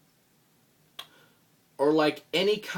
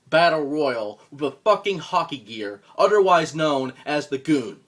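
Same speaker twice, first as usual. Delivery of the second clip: loud scornful rant; 8 dB louder than usual. Acted. Loud insulting rant at rival.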